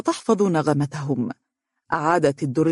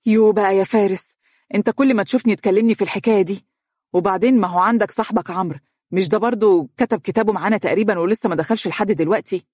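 irritated; 4 semitones higher, faster than usual. Acted. Dunya angrily complains to Faris, exasperated. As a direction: snap back in annoyance. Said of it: "وقالت حانقة".

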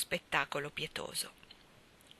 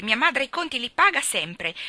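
put-on indignant child, somewhat raised voice; angry retort; 5 semitones higher, 6 dB louder than usual. A narrator's reading. Ivy defends her mother to the twins, angrily. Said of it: "Ivy Trent montò in collera".

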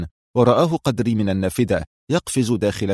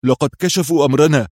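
higher-pitched, rattled announcement; flustered; 4 semitones higher, 4 dB louder than usual. A narrator's reading. Qadri alarmed by knocks at the door, flustered. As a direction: blurt it with rising alarm. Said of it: "فاضطرب قدري وهو يتمتم".

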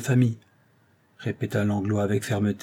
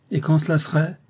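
higher, self-assured retort; 6 semitones higher than usual. A narrator's reading. Doctor answering the actress's accusation of love, assured. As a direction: answer with confidence. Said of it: "d'une voix assurée".

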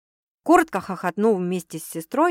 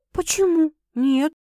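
bewildered, higher-pitched reply; puzzled; 7 semitones higher than usual. A narrator's reading. Girl answering her sister's guess, puzzled. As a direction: answer as if baffled by the question. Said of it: "недоумённо ответила Саша".

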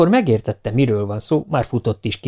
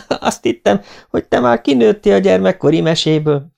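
high voice broken by laughter; laugh, then speak through it; nevetve; 9 semitones higher, 4 dB louder than usual. A narrator's reading. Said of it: "úgy nevetett és köhögött tovább". (magas hangon, kacagva)

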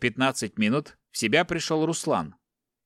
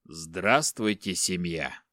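sinister greeting; 5 semitones lower, much slower than usual. Acted. Captor greeting the bound family, low and slow. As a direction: savour the greeting darkly.

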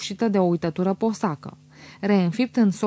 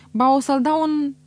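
blunt; 6 semitones higher, 4 dB louder than usual. A narrator's reading. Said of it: "spuse pe șleau căpitanul".

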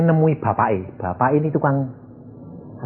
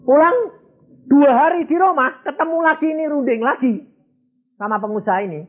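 1 excited build; 10 semitones higher than usual. Real, not acted. Preacher building the story climax.

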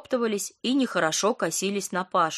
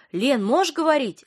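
higher, urgent burst; blurting out; 7 semitones higher, 4 dB louder than usual. A narrator's reading. Patient phoning her friend, urgent blurt. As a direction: blurt it the moment she answers. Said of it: "выпалила она".

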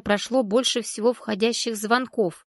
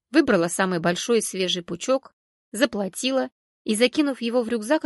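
ordinary delivery

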